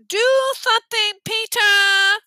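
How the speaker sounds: high, loud, slow demand through a held nose; stuffed-nose yell. A narrator's reading. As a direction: yell for help with your nose pinched. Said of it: "Sheila yelled".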